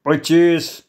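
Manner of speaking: firm, drawn-out figure; firm demand. Acted. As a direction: state the price as a demand. Knife-holder coolly names his sum. (ठामपणे)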